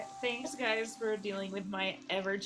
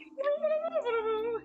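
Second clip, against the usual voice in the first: very high, loud sustained "oooh"; imitated warble; 5 dB louder than usual. Player mimics actors switching voices, high loud warble. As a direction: burst into a high, silly put-on warble. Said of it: "they can just be like".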